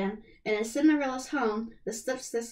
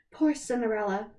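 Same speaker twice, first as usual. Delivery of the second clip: usual speaking voice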